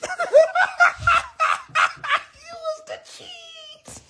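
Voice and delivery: tiny high-pitched voice; squeaky high punchline. Acted. Comic delivering the cheese punchline, squeaky high voice.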